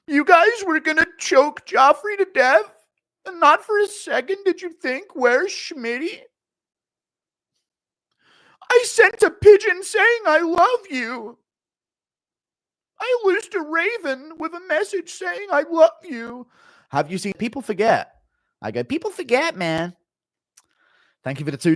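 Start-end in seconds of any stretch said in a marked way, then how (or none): 0.1-18.2 Schmitty impression. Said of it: "Impression of Schmitty on a trial". 18.8-19.9 Schmitty impression. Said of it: "Impression of Schmitty".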